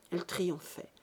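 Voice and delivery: usual speaking voice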